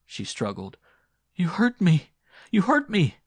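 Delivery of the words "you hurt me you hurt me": high, loud repeated shriek of protest; panicked cry. Acted. Woman struggles in the man's grip, crying out. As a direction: cry out sharply, struggling to get free.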